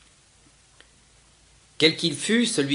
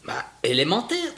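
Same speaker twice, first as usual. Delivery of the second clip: higher, clipped dismissive retort; dry curt tone; 6 semitones higher than usual. Acted. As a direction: brush off the obvious question. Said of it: "dit-elle d'un ton sec". (ton sec et dédaigneux)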